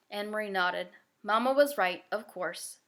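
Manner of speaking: ordinary delivery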